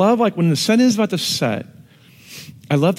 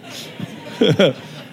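breathy laugh; 2 semitones higher than usual. Real, not acted. Laugh after joke.

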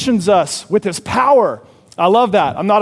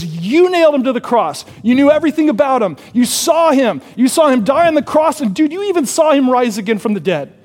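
higher, accusing mock-sermon voice; Baptist preacher; 4 semitones higher than usual. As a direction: preach it at them like an indignant Baptist. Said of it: "preaching like a Baptist".

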